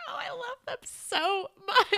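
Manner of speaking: very high, squealed fondness; gushing adoration. Retold or imitated. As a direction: gush over them, voice way up high. Gushing over beloved characters.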